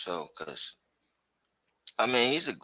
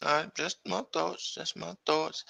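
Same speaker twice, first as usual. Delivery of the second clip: high, soft put-on voice; mock-meek disclaimer; 9 semitones higher, 4 dB quieter than usual. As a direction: back off in a small high voice. Playfully backing off after disagreement.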